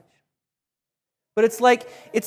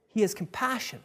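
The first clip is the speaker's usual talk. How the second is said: normal talking voice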